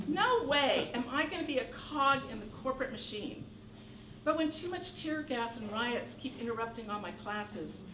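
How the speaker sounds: usual speaking voice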